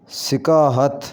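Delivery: सिकाहत opening on 'si'; correct pronunciation. Shown as a demonstration. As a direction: say सिकाहत as 'si-kaa-hat'. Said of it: "इसको सही इस तरह करके पढ़ेंगे". (सिकाहत के 'स' के नीचे ज़ेर बोलें)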